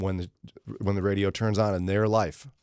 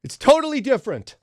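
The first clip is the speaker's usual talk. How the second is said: smug echo; 7 dB louder than usual. Retold or imitated. Echoing the caller's line.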